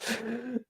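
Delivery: very high, soft laugh; a breathy little laugh at the chat. Real, not acted.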